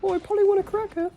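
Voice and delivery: squawky high parrot voice; parrot impression. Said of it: "my parrot impression".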